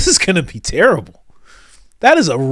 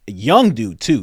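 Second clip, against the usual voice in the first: normal talking voice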